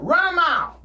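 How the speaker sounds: loud, high coach's command; coach barking. Retold or imitated. Coach ordering the runners to run a mile, loud bark. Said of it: "He said".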